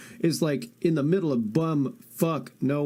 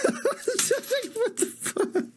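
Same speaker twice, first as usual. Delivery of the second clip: very high, slow, laughing disbelief; laughing too hard to get it out; squealing laugh; much slower than usual. Real, not acted. Streamer cracking up at chat's joke, squealing through laughter.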